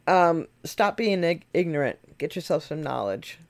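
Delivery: usual speaking voice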